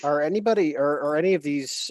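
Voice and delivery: usual speaking voice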